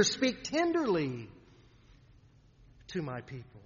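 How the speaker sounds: a still small voice, gentle and hushed; tender voice. Shown as a demonstration. Preacher showing God's still small voice, softly tender. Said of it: "that is this voice here".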